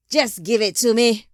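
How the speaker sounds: very loud, impatient demand; angry insistence. A narrator's reading. Father cutting off son's protest, angry shouted demand. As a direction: override the protest with a shouted order.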